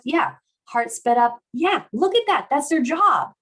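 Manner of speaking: high, emphatic validation; excited affirmation. Real, not acted. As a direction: cheer on each reaction, pitched well up. Affirming participants' reactions as they come in.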